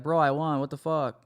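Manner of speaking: normal talking voice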